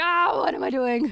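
high, loud comic dismay; hammed-up game developer despair; mock panic. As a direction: cry it out in pretend panic. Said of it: "game development in a nutshell. Like".

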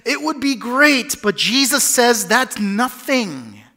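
high, loud preaching; rising fervor. Real, not acted. Pastor driving home the point, loud fervor. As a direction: build it up with full force. Building sermon climax.

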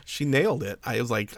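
ordinary talk, nothing marked

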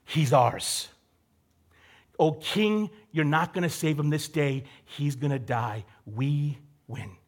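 low, soft, gloating whisper-like taunt; mock the king from below, quietly smug; lightly voiced scheming nobles; gloating conspirators. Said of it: "they're like".

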